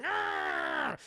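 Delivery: high, drawn-out yell; villain's lunging battle cry; attack cry. Acted. Goblin attacking.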